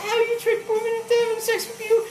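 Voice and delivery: very high imitated woman; mock woman's voice. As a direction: act out the wife's question way up high. Voicing the woman in a joke.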